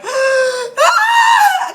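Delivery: high, loud wail of shock; re-enacted crying wail. Reliving the dream of the whale dying, wailing. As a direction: burst out in a dramatized sob-scream. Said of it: "I was, like, crying".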